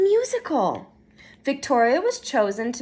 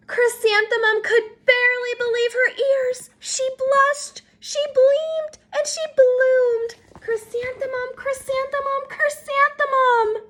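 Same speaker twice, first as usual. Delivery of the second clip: much higher, glowing with delight; breathless happy wonder; joyful; 10 semitones higher than usual. A narrator's reading. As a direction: let the joy bloom in the voice. Chrysanthemum blooms with joy.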